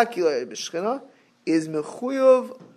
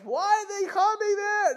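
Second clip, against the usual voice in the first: high-pitched mock whine; mock annoyed; 4 dB quieter than usual. Mock host mad that guests are coming, high annoyed whine. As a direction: complain as if put out by visitors. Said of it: "you know, you're mad".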